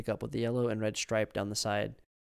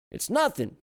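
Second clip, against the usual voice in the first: put-on old man voice; 13 semitones higher, 6 dB louder than usual. A narrator's reading.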